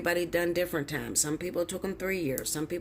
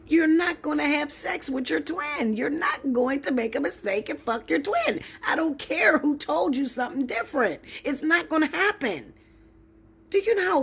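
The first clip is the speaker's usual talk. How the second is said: heated; 8 semitones higher, 4 dB louder than usual. Real, not acted. Heated rant.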